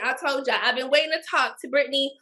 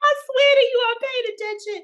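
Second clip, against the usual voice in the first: laughing; 10 semitones higher than usual. Real, not acted. Laughing protest to cohost.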